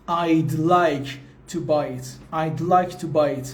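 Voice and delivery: "I'd like" with "would" reduced to a d; slow model of "I'd like to buy it"; contracted form. Shown as a demonstration. Teacher modelling the contraction for students, "I'd like" slowly contracted. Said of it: "مخفف تلفظیشه".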